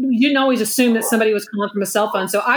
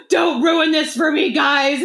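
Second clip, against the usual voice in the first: higher, slow dramatic plea; mock pleading; 4 semitones higher, slower than usual. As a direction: imitate an upset bride begging friends. Imitating bride pleading with pranksters.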